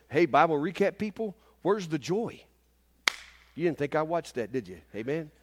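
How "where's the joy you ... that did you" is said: usual speaking voice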